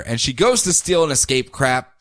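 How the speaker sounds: ordinary talk, nothing marked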